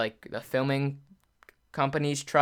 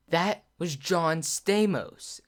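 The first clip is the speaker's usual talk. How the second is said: slow, higher-pitched mock awe; awestruck reveal; 5 semitones higher, much slower than usual. Mom reveals who the guy was, awestruck. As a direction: draw it out like a starstruck mom.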